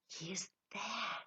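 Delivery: slow, soft whisper; hushed, pleased awe; awed whisper. A narrator's reading. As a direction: breathe it in reverent wonder. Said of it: "whispered in a tone of awe".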